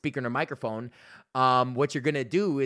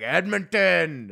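put-on loud, slow drunk heckler yell; drunk shout; 5 semitones higher, 5 dB louder, much slower than usual. Drunk heckler shouting city names.

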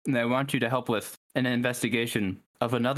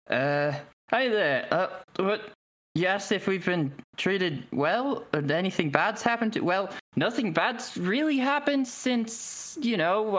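higher-pitched, hesitant guard; halting answer in a higher character voice; Mintarn guard; 8 semitones higher than usual. Acted. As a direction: play an unsure guard in a raised voice. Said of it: "one of the Mintarn guards just looks at you and goes".

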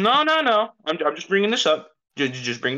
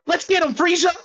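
excited shout; 14 semitones higher than usual. Acted. Player urging attack, shouted.

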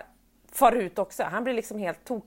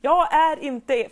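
fed-up outburst; 5 semitones higher, 7 dB louder, much slower than usual. Retold or imitated. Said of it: "jag bara".

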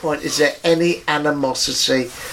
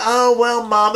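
mock sweet butler; 8 semitones higher than usual.

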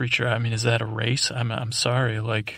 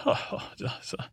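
breathy whispered oh, oh, oh, far softer; whispered reply; 7 dB quieter than usual. Acted. Creature answering the podcaster in a whisper. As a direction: hush it like a secret.

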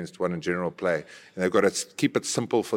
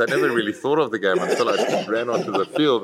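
talking through a laugh; 2 semitones higher, 5 dB louder than usual. Real, not acted. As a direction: say it while still chuckling. Laughing while answering.

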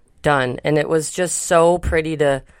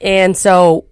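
louder, eager storytelling voice; 5 dB louder, slower than usual. Real, not acted. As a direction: push the volume up a bit. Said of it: "I'm talking too loud".

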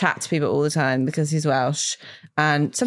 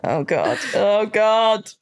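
mock dismay; 3 semitones higher, much slower than usual. Dog owner reliving the horror of her dog's find, mock dismay.

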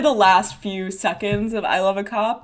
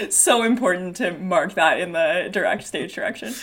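ordinary talk, nothing marked

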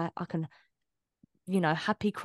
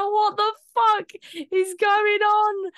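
high, loud, drawn-out shocked squeal; 8 dB louder, slower than usual. Retold or imitated. Partner reacting to her sudden switch, high shocked squeal. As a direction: squeal it in disbelief like the stunned partner.

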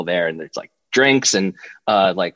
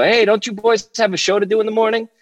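lightly imitated, higher-pitched call from a car; Michael Strahan; 11 semitones higher than usual. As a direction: playfully call out a teasing question. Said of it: "you hear this voice go".

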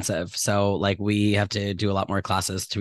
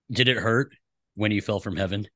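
laid-back bro voice, low pickup line; lightly voiced gym bro cheesy line; 3 semitones lower than usual. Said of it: "pickup line in your bro voice".

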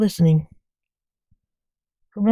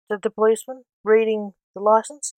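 plain reading voice

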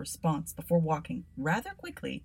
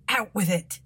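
impatient policeman; 3 semitones higher, 6 dB louder than usual. Acted. Constable cuts him off.

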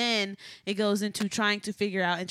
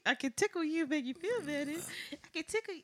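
put-on playful baby talk; 7 semitones higher, faster than usual. Teasing playful high voice.

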